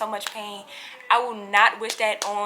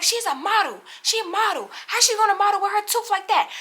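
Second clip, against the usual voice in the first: high-pitched outraged mom imitation; mom going off; 8 semitones higher than usual. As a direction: act out a mother's indignant protest, pitched way up. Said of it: "we both was going off".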